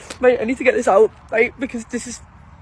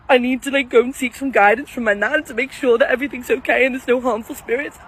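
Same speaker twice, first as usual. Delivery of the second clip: ordinary delivery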